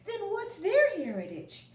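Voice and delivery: put-on high child voice asking; curious little boy. Mancho asks his grandpa a question, high and curious. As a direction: ask it like a wondering kid.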